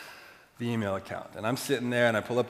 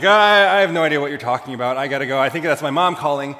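loud, fast, higher, rushing out of there; panicked denial; 4 semitones higher, 12 dB louder than usual. Retold or imitated. Teen caught by his friend's dad, rushing a loud, panicked denial. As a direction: blurt it out in a rush. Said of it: "And I'm panicking".

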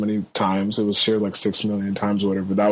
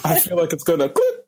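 higher, stopped dead mid-word; mimicked cut-off speech; 6 semitones higher than usual. Replaying Becky getting cut off, mimicked cut-off. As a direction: imitate a recording chopped off, amused.

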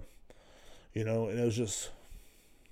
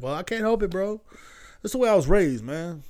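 mock excuse; 7 semitones higher, 3 dB louder than usual. Quoting his own excuse.

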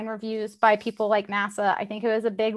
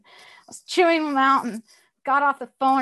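ordinary delivery

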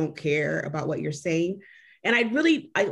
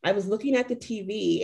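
normal talking voice

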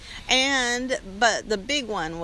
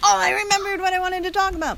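excited; 10 semitones higher, 6 dB louder, faster than usual. Real, not acted. Sudden recall, excited.

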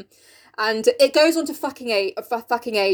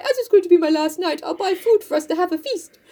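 higher-pitched comic character voice; Mister Bear; 5 semitones higher than usual. Acted. Bear promising Jo a last-night feast, put-on voice. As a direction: lift it into the put-on suitor's voice. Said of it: "he's like".

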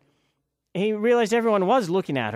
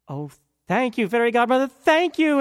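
grateful Cinderella; 7 semitones higher, 6 dB louder than usual. A narrator's reading. Cinderella thanks godmother.

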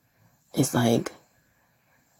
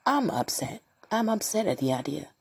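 ordinary talk, nothing marked

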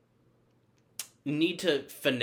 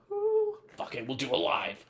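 a squeaky, much higher "ooh, fuck it"; playful high-pitched shrug; high mock whoop; 12 semitones higher than usual. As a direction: toss it off in a high silly voice. Mock carefree decision in high voice.

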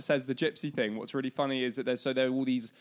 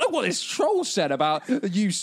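put-on higher, louder childish taunt; dopey cartoon bellow; 8 semitones higher, 6 dB louder than usual. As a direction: bellow like a silly cartoon troll. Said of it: "They're like".

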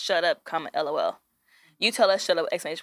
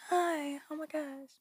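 much softer, higher breathy greeting; 5 semitones higher, 13 dB quieter, slower than usual. Shown as a demonstration. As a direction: demo a soft sweet hello. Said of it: "soft, like".

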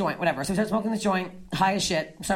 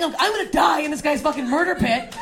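ordinary delivery

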